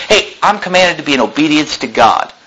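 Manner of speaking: ordinary talk, nothing marked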